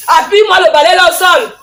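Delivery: loud harsh scolding; angry scolding. Acted. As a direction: tear into the lazy girl. Stepmother scolding girl sweeping. (réprimande furieuse et forte)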